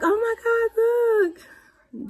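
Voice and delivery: high, slow squeal then a whispered look; imitating the kids gasping at the ultrasound; excited kids. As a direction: gush like the kids seeing the picture. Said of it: "They're like".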